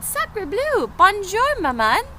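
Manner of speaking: high, bright-voiced baby duck with French flair; duckling voice. Newborn duck greets Jack.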